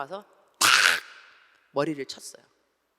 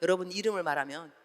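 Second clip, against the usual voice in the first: ordinary talk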